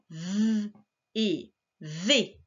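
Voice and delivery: "V" as a long buzzed vvv, then "et", then "V" blended as vé; sounding out. Shown as a demonstration. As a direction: hold "V", pause, say "et", then join them. Teacher sounds out a syllable.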